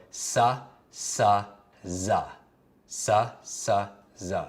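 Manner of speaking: "s, s, z" as suh, suh, zuh; S and Z sounds. Shown as a demonstration. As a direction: sound out "s, s, z" one by one with uh. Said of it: "the zero is the S sound".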